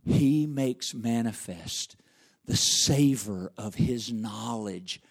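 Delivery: deliberately slow, holding back; slow and low. Shown as a demonstration. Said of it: "I'm really trying to go slow".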